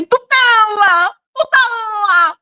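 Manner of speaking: "tutela" high and dragged out, swallowing the L; slow whiny mock of a botched "tutela"; mocking mispronunciation. Imitating what learners say, slow high mangled "tutela". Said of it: "When you say".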